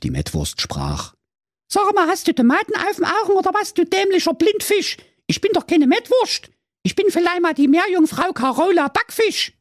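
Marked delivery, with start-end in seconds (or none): 1.7-9.5 very high, fast cheeky mermaid voice; sassy mermaid. Acted. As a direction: snap at the prince like a saucy mermaid.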